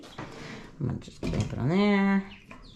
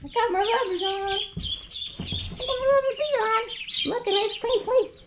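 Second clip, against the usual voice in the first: much higher coaxing coo; high soothing pet talk. Real, not acted. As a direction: soothe the ducklings like babies. Coaxing ducks into new spot.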